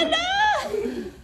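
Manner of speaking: very high, drawn-out name through laughter; laughing wail. Real, not acted. Player wailing her friend's name through laughter.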